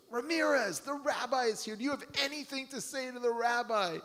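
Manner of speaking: high, pushed guard voice; guard's impatient call. Retold or imitated. Guard's third impatient call into the cell. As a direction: demand an answer, third try.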